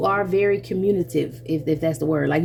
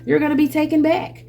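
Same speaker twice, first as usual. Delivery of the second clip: ordinary delivery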